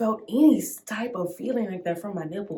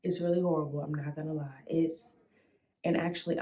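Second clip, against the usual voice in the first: normal talking voice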